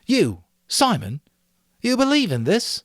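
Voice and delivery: can't believe what he hears; amazed Ralph. A narrator's reading.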